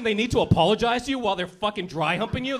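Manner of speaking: ordinary delivery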